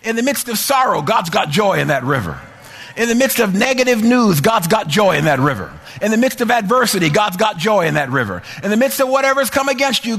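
loud, rising, shouted preaching; preaching at full force. Acted. Preacher stirring the congregation to shout, loud and high. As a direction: build it up to a joyful shout.

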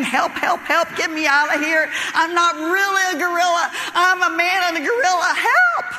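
mock-panicked yell rising to a high last shout. Man in gorilla suit yelling to escape the lion, panicked. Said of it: "screaming and yelling".

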